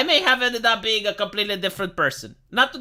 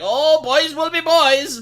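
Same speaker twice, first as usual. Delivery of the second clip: higher, louder, drawn-out dismissal; indulgent mock parents; 5 semitones higher, 5 dB louder, slower than usual. Parents waving away a school fight, indulgent and drawn out. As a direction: brush it off with a long, lilting "oh".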